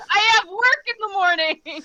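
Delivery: high, loud comic protest; mock-despairing wail. Tired bar-goer protesting another round, mock-despairing wail. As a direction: wail it in playful exasperation.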